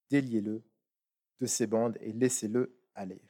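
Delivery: measured, solemn scripture reading; Bible reading aloud. Acted. Lit à voix haute un passage biblique. (lecture solennelle de l'Évangile)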